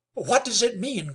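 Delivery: high, loud alarmed question; alarmed cry. Acted. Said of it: "cried Jim".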